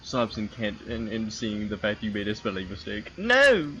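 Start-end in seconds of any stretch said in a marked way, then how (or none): 3.2-3.7 loud. Acted. Mock despair at a typo.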